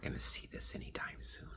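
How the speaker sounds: dropped to a secret whisper; confiding hushed aside. Real, not acted. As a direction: lean in and whisper it. Aside meant only for Blanca.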